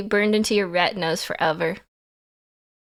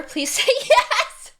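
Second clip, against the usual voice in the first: laughing plea; 12 semitones higher, 4 dB louder, slower than usual. Real, not acted. Begging, laughing.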